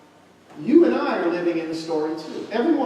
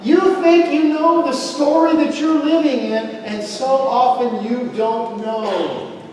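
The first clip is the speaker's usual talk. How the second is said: loud, higher, fervent preaching; preaching at full force; 6 semitones higher, 4 dB louder than usual. Acted. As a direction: lift it up and hammer the lesson home. Preacher drives home the point.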